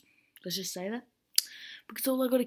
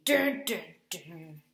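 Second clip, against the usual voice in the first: suspense dun dun dun; 4 semitones lower, 3 dB louder, slower than usual. Pretend. Suspense sound effect.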